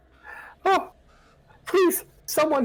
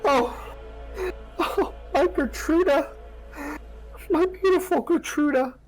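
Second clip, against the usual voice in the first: wailing mother; 14 semitones higher than usual. Acted. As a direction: cry out for the lost child. GM voices crying mother.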